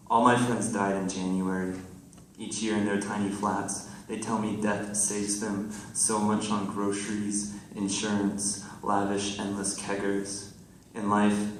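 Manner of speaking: projected, deliberate verse delivery; poem reading. Acted. Poet reading to friends in the audience, projected recital. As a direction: recite it to the room in measured phrases.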